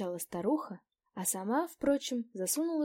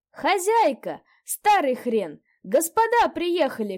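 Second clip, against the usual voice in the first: loud calling out for the landlady; shout; 9 semitones higher, 11 dB louder, slower than usual. A narrator's reading. Narrator calls the landlady out of the house, shouting. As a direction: call across the yard at full voice. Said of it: "крикнул я".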